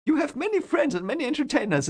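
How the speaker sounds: high, quick, eager-to-please voice; trying to be nice. Acted. Rand making small talk with Barthanes, high and over-nice. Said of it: "trying to be nice".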